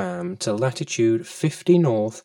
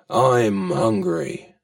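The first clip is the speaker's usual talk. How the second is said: whale voice; 5 semitones lower, slower than usual. Acted. Whale demands food.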